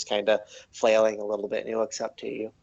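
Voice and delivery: usual speaking voice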